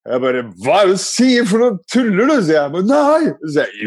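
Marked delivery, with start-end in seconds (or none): 0.6-2.4 high-pitched incredulous question; mock-shocked, pitch leaping up; retold disbelief. Disbelieving father answering son. 2.9-3.3 put-on high little-boy protest; squeaky kid's denial; imitated insisting son. Son insisting in retold argument.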